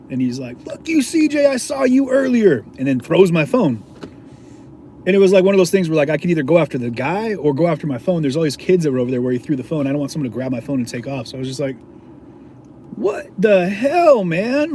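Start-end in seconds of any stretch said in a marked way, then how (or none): 0.6-2.6 high-pitched jeering shout; exaggerated, drawn-out hostile holler; mock angry yell. Imitates hostile man on scooter. 12.9-14.7 slow, high-pitched protest; drawn-out retold outrage; indignant disbelief.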